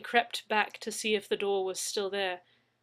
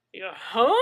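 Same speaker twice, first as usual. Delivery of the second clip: surprised exclamation, louder; exclaiming; 9 dB louder than usual. A narrator's reading. Mad Zeke exclaims to Leslie in surprise. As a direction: blurt it out in shock. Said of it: "Mad Zeke exclaimed".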